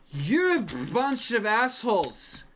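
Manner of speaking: put-on very high, loud, tearful drunk rant; drunken rant. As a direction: rail at them, nearly crying. Drunk rants about time loop.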